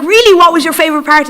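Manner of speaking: high, loud, eager question; light up like an enthusiastic teacher; encouraging teacher asking about the book, excited. Retold or imitated. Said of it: "he got excited with me".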